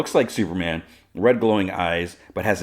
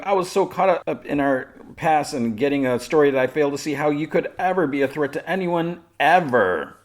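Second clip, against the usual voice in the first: higher-pitched, running belittling tirade; scornful goading; 8 semitones higher than usual. Retold or imitated. Lois belittling Toyman to provoke him, scornful. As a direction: pile on the disdain, imitating her.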